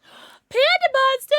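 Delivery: high, loud, slow squeal of the pet's name; delighted call to the pet; gasping pet-greeting squeal; excited greeting. Real, not acted.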